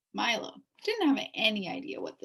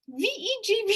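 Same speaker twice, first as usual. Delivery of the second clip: rushed spelling; 12 semitones higher, 5 dB louder than usual. A narrator's reading. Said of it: "spelled as fast as he could".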